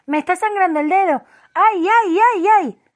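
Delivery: high, loud cries of pain; panicked queen howling over her finger; shrieking wails. A narrator's reading. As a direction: wail like a train whistle. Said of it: "Sus alaridos se parecían tanto al silbato".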